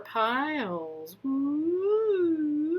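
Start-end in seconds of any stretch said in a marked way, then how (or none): 1.2-2.8 high, playful whoop; a quick cheer while cutting the deck; playful woo. Real, not acted. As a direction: let out a little fun cheer. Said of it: "just having a little fun".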